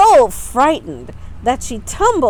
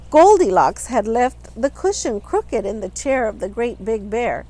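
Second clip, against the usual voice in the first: usual speaking voice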